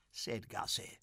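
usual speaking voice

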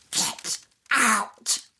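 put-on high, loud ghost-girl snarl; a furious child ghost ordering them out; angry little girl. A narrator's reading. Ghost girl on the recording telling them to leave, angry high shout. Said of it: "the voice sounding like an angry little girl".

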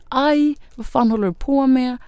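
a crying cry of pain, pitched high; retold cry. Pushed into a puddle, crying at him in pain. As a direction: cry out hurt and shocked. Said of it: "jag bara gråter och skriker och jag säger".

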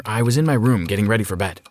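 usual speaking voice

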